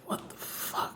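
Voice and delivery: very soft, whispered shock; stunned disbelief. Retold or imitated. Bandmate sums up the unexpected stunt, stunned whisper. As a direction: whisper it as a dumbfounded inner reaction. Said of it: "not expecting to happen".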